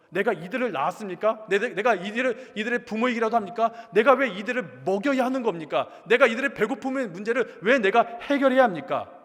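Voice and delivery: indignant protest. Retold or imitated. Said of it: "가서 이렇게 따집니다".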